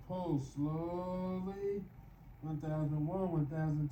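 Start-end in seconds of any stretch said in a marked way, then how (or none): 0.1-1.8 long, stretched "slowly"; slow exercise cue. Real, not acted. Exerciser paces the third pull, drawn out. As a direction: draw the word out with the pull.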